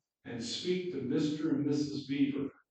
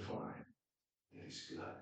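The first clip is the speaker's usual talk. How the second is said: hushed whisper; 16 dB quieter than usual. Real, not acted. Preacher's hushed close.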